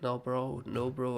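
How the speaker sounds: ordinary talk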